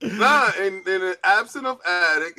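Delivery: high-pitched impression of an absent co-host; hammed-up co-host voice, announcing; Addict. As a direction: imitate your co-host, pitched well up. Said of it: "in my Addict voice".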